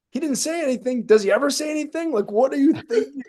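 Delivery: high, laughing exasperation; high laughing incredulous rant; exasperated disbelief. Retold or imitated. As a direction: plead in baffled disbelief. Exasperated retold reply, laughing.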